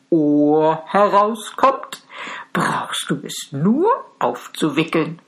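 magic cow voice. Acted. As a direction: speak as the helpful cow, unhurried.

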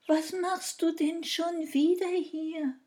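high, loud fairy voice. A narrator's reading. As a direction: voice a fairy, pitched well up. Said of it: "hörte er die Stimme der Fee". (hohe Feenstimme)

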